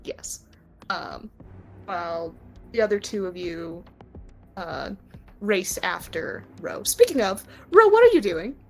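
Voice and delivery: ordinary talk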